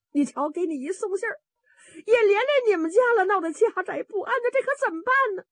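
high, tearful lament; acted sobbing complaint, pitched high; crying. A narrator's reading. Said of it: "这贺大奶奶也哭啊".

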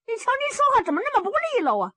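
high, quick voice with a thick tongue; high-pitched lisping youngster; tongue-tied son. A narrator's reading. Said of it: "盖黑杰说话也有毛病， 有时".

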